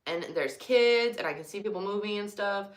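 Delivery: ordinary talk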